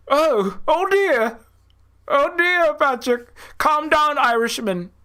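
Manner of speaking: high-pitched parody of genteel fretting; mock prim dismay. Villager soothing an angry Irishman, mock prim dismay. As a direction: tut in a put-on high, fussy voice.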